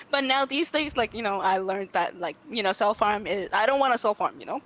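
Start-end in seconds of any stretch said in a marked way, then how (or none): none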